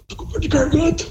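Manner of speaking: feigning a sore throat to dodge; fake sore throat voice. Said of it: "falando que eu tava com uma laringite". (voz fingindo dor de garganta)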